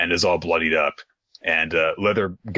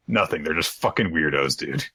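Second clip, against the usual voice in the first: ordinary talk, nothing marked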